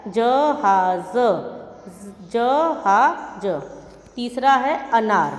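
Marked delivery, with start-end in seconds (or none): 0.1-3.5 slow spelled-out word. Shown as a demonstration. Teacher showing pupils how to say जहाज, very slowly drawn out. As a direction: stretch जहाज out very slowly, syllable by syllable. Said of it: "तो ये हो गया".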